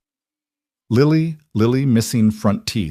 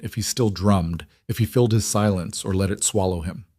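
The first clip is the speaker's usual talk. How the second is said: usual speaking voice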